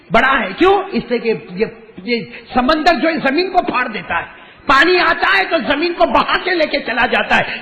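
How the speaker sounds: high, loud, swelling sermon voice; fiery preaching. Acted. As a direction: build the lesson up to a roar. Preaching at rising force.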